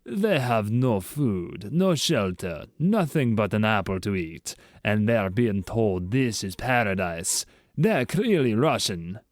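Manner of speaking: slow, heavy Russian voice; Russian accent. Acted. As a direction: drag it out like a gloomy Russian. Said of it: "The Russian says".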